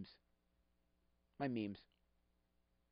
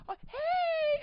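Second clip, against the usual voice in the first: much higher, louder cry of oh, hey; squealed hello; 4 dB louder than usual. Retold or imitated. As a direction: squeal it like a delighted reunion. Someone greets excitedly.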